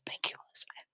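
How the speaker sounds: whispered thanks to her husband; quietly thanking her husband while he takes her hand. Real, not acted. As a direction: breathe it softly aside. Aside to husband holding hand.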